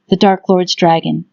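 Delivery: plain reading voice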